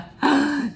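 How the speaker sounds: sharp storyteller's gasp; dramatic gasp. Acted. Reader warns children of coming crash, dramatic gasp. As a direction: suck in air in suspense.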